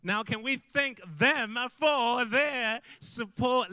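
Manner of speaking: loud, slow, high call to the crowd, drawn out; rally the room to cheer for their leaders; rousing call to applaud. Real, not acted. Preacher to congregation, thanking leaders: loud, slow rallying call.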